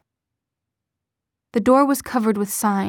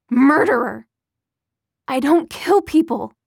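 loud, high, panicked accusation; frightened mother screaming through the door; terrified yelling; 4 semitones higher, 6 dB louder than usual. Acted. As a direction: scream it at the monster outside.

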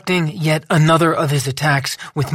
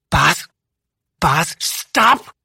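higher desperate call; Lou, desperate; 4 semitones higher, much slower than usual. A narrator's reading. Lou to Boz, higher, desperate. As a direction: beg him to stop.

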